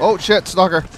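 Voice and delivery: startled loud cry of warning; alarmed shout. Real, not acted. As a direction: jump and yell the warning. Sudden enemy appears.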